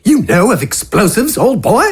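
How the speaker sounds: relishing the idea of explosives; Wither's voice. Acted.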